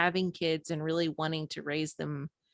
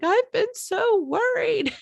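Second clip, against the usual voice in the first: retold tearful cry, much higher, slow and louder; 6 dB louder, slower than usual. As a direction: let the worry break out in tears, high. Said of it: "I just burst into tears".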